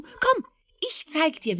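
very high eager invitation; eager rabbit. Acted. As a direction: coax him along. Hase lädt Fuchs ein. (hohe, eifrige Hasenstimme)

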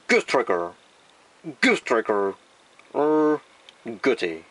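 slow, drawn-out English product names; mock English accent. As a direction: announce toys like an English ad. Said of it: "英語っぽく言ってみます".

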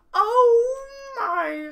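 very high loud wail; loud dismayed cry. Acted. Winky dismayed by train noise.

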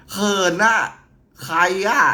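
high, loud mock-shy squeal; hammed-up coy outburst; playfully bashful. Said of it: "ตลกตัวเองว่ะ".

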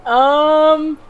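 high, loud mock Cockney yell; mock British shout. Joking to Brad in a loud Cockney. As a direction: bellow it like a rowdy Brit. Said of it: "No, I'm just kidding".

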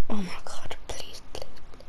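breathless whispering; whispered plea. Real, not acted. Player begging after a scare, whispered plea.

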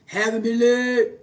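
gently mocking church recital; mock church creed recital. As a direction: recite it like a congregation droning the covenant. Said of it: "about to make a joke".

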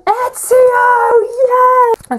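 high, loud, stretched delighted cry; gleeful fan squeal; excited squeal. Real, not acted. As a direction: squeal with joy at the figure. Excited reveal of figure.

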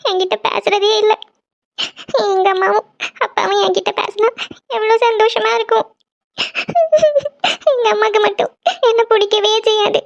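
crying. Acted. Janani crying about her mother.

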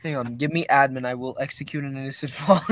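ordinary talk

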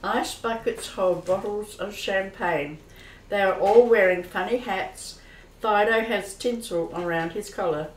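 ordinary talk

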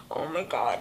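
very soft, low, panicky aside; imitated anxious dread. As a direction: imitate someone quietly near panic. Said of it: "about to have an anxiety attack".

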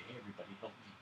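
ordinary talk

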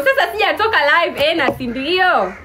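normal talking voice

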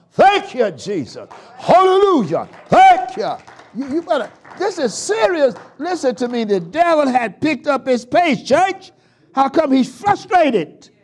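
loud, fast, high preaching cadence; whooping preacher build; preaching at full force. Real, not acted. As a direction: drive the sermon home to the church.

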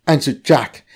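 usual speaking voice

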